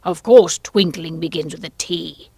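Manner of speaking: loud, sharp royal rebuke; sharp retort. A narrator's reading. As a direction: answer the witness sharply and loudly. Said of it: "said the king sharply".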